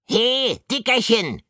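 high, loud mocking call of the rat; jeering yell. A narrator's reading. Jealous Ratterich yells the nickname at Pikandu, loud jeer. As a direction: bellow the taunt through the window. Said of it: "grüllte Ratterich noch einmal".

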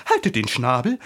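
loud, indignant order; excited scolding call; agitated shout. A narrator's reading. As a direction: snap loudly for silence. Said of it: "rief die Tigerlilie ... zitternd vor Erregung".

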